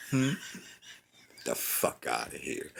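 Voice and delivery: ordinary delivery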